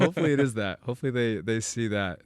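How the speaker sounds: laughing. Real, not acted. Guest laughing, still amused.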